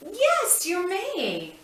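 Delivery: high-pitched, thrilled yes; excited praise. Acted. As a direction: cheer him on for asking. Therapist rewarding child's request.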